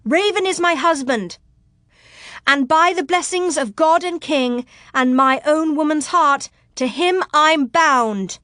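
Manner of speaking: high, proud public declaration; ringing tones. Acted. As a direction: proclaim it like a vow from the battlements. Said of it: "still letting her voice rise in ringing tones".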